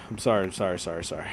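apologize, fading away; fading apology. Real, not acted. Streamer apologizing for scaring viewers, trailing off softly.

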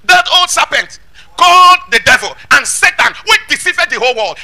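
loud, high preacher's declamation; ringing pulpit reading; forceful scripture proclamation. Acted. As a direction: proclaim the verse at full force, leaning on key words.

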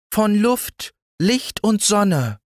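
very high, slow mayfly voice; dreamy mayfly. A narrator's reading. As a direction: savor each word like a dreamy mayfly. Mayfly answering.

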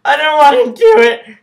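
high, loud, whining protest; whiny refusal. Real, not acted. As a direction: squeal like you dread it. About to eat mushy beets.

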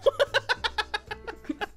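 high-pitched trailing laugh; high laughter after an uh-oh; nervous laugh. Real, not acted. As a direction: giggle nervously.

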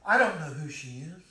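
normal talking voice

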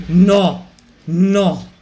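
loud, high angry yell; furious shout. Real, not acted. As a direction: explode at being knocked out. Eliminato dalla gara. (urlo furioso, forte e acuto)

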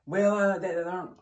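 high, loud, caught-off-guard stammer; flustered stammer. Retold or imitated. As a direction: scramble for an excuse.

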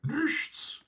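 tiny very high squeaked answer; high-pitched hound voice; talking dog. A narrator's reading. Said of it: "sagte der Hund".

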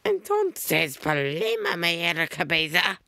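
put-on very high, cutesy but creepy girl; sugary high squeal into an eerie whisper; Amelia's creepy adorable voice. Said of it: "sounds like a creepy, adorable voice".

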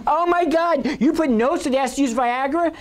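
high, mocking teenage voice; teen boys teasing. Older brothers teasing their little brother at dinner, high mocking voice. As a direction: lightly ham up older brothers egging on Andrew. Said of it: "the two older boys are sitting there saying".

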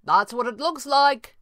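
high, slow reply; calling back. A narrator's reading. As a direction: call the answer down from above. Answer called down from above.

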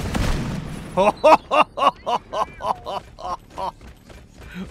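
loud, high belly laugh dying away; long run of ha-ha laughs, fading. Real, not acted. Player amazed by the game, long loud laughter.

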